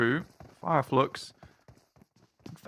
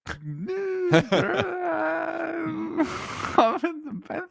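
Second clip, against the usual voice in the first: a burst of high squealing laughter. Real, not acted. Co-caster reacts to praise with a high squealing laugh. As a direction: squeal with delight.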